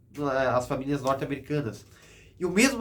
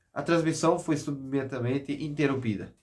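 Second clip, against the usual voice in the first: ordinary talk, nothing marked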